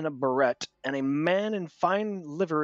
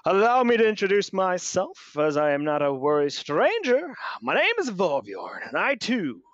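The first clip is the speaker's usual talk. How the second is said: loud, high, pompous self-introduction; theatrical noble introducing himself; grand introduction; 5 semitones higher, 4 dB louder than usual. Acted. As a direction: announce yourself with flourish. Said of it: "He goes".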